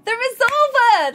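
loud, high, drawn-out name; corrected high shout-out. Shown as a demonstration. Streamer redoing a gifter's shout-out, high and loud. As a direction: call the name out high and proud. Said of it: "That's what I meant to do".

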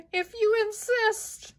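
high squeaky skunk voice. Acted.

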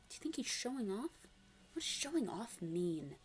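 ordinary delivery

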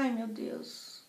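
very soft, slow retold groan; weary sigh. Woman who wants to be alone, quietly exasperated. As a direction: mutter it wearily under the breath. Weary inward reply to friend.

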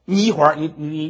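usual speaking voice

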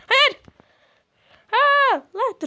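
high loud cry of no; real alarmed yell; shout. Real, not acted. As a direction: yell it in dismay. Failing in game.

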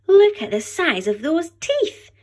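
put-on high, loud, amazed gasp of a boy. Max staring up at the T-Rex, amazed high gasp. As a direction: gasp it out in wonder at the huge teeth. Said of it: "gasped Max".

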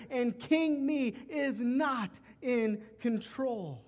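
fervent repeated declaration; preaching at full force. Acted. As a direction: drive each line home from the pulpit. Anaphora build, louder.

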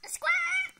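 shrill high bird squawk; parrot squawk. Acted. Bird sister squawks at speaker.

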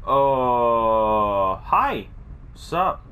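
usual speaking voice

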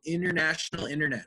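"international internet" as "innernational innernet"; silent T. Shown as a demonstration. Teacher shows students the T after N, silent T. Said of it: "they are silent most of the time".

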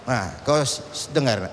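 ordinary talk, nothing marked

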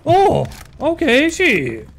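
startled high yell into a curse; raging gamer. Real, not acted. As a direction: shout in shock then swear. Gets hit by surprise.

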